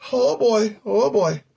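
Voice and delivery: usual speaking voice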